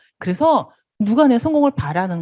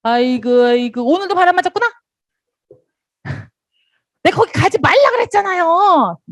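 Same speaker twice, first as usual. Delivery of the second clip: higher, tutting sympathy with a laugh; mock consoling sponsor; 9 semitones higher, 3 dB louder than usual. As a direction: cluck over them like a fussing sponsor. Sponsor consoles a stood-up member.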